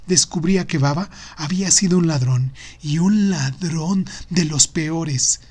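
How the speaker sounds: usual speaking voice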